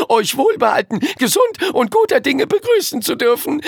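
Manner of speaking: high, fast, gushing courtly voice; effusive courtier. Acted. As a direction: gush with flowery delight. Flowery Chinese courtier greeting.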